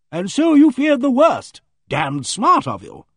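loud, high, brisk know-it-all; hearty bossy lady. Acted. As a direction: play her booming and self-assured. Aimee Griffith's hearty voice.

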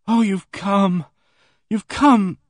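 high, loud, shaken cry of relief; cling to him with relief; a frightened girl greets her rescuer, high and loud; distraught relief. Acted.

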